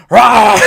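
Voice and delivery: loud high mock monster roar; jump-scare growl, hammed up; werewolf roar. Retelling the werewolf attack, loud mock roar.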